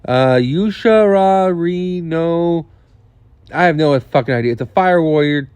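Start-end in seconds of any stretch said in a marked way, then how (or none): 0.4-2.7 Yushara Rino sounded out very slowly, syllable by syllable; laboured, drawn-out attempt at saying Yushara Rino; struggling to pronounce. Real, not acted. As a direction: stretch out each syllable of Yushara Rino, unsure of the name. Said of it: "I can't pronounce this thing".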